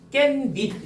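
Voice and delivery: 깻잎 as kken-nip, stretching the double n; demonstrated 깻잎. Shown as a demonstration. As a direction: dwell on the n-n in 깻잎. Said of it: "이렇게 발음되잖아". ("깬닙"으로 니은을 길게)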